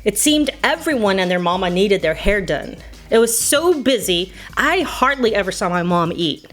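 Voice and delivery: ordinary delivery, nothing marked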